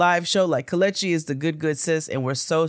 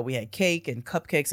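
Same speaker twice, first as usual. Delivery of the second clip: usual speaking voice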